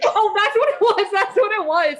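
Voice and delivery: laughing, high and loud; laughing realization. Real, not acted. Friend laughing in sudden recognition. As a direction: laugh it out as it clicks.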